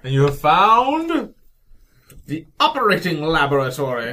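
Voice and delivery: slow, grand room reveal; dramatic announcement. Acted. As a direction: announce it like a spooky host.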